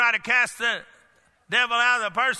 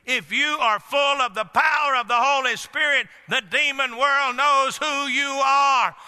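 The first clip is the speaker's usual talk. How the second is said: full-force preaching; 3 semitones higher, slower than usual. Acted. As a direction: build it into a fiery cry.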